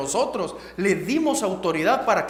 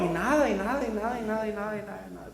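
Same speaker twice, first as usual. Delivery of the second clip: fading repetition; 6 dB quieter, faster than usual. Shown as a demonstration. Repeating the silence, fading.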